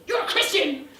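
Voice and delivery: loud, high outburst of disbelief; shocked accusing shout. Retold or imitated. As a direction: hurl it at her like an accusation. Angry addict confronts her.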